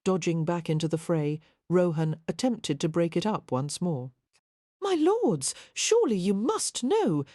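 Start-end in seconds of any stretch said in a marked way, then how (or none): 4.8-7.2 much higher, pleading exclamation; exasperated appeal. Acted. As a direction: lords rising high in strained appeal. Peacemaker pleading mid-fight.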